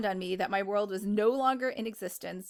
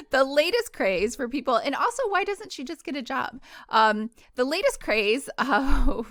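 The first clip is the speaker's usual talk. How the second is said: louder, giggling while reading; laughing through it; 3 semitones higher, 5 dB louder than usual. Real, not acted. Reader laughing at her own aside mid-sentence. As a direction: push words through giggles.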